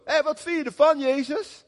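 mock crowd shout, pitched high; calling out. Crowd demanding an answer from Jesus, acted calling out in a high voice. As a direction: call out a challenge as if from a crowd. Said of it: "jullie lopen te roepen".

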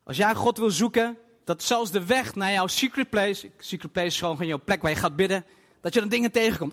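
ordinary talk

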